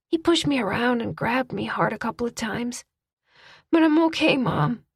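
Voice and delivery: put-on a child trying to be brave; young boy. A narrator's reading. Child voiced by narrator.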